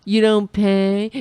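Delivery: a hint of a blustering boss, slow, "pay" leaned on; half-hearted Trump impression; mock Trump taunt. Mocking Trump scolding allies, lightly voiced and slow. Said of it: "if we stop saying".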